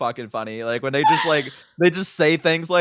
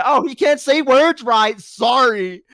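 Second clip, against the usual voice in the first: loud, high, drawn-out mocking; sarcastic mock apology; 8 semitones higher, 5 dB louder, slower than usual. Mocks being picked on for speech.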